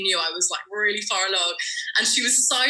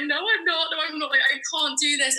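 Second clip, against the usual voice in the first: high, upset denial; 7 semitones higher than usual. Retold or imitated. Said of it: "And I was like".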